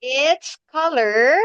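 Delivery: slow, clear teacher model; slow model sentence. Shown as a demonstration. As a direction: spell it out slowly for the child. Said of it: "teacher models answer slowly".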